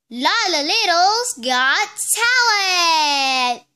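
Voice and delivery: slow, loud show-title shout; stretched-out announcer shout; big announcement. Acted. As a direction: call the title out like a showman.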